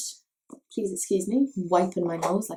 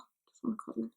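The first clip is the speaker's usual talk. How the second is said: much softer, fond; tender; 2 semitones higher, 15 dB quieter, much faster than usual. Real, not acted. About her sweet Turkish friend, soft and fond. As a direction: say it softly with affection.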